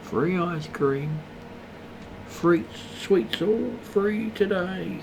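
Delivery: slow, high creepy luring voice; child catcher. Retold or imitated. Mocking a free game ad as the child catcher. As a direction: lure the kids in with sweets. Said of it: "like the old child catcher on Chicky Chicky Bang Bang".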